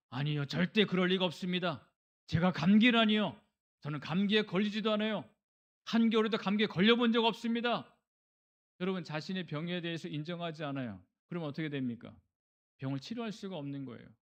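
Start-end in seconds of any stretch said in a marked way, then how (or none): 0.1-7.9 loud, high-pitched protest; indignant denial. Acted. Patient refusing the doctor's cold diagnosis, indignant denial. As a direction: deny it outright, pitched up and forceful.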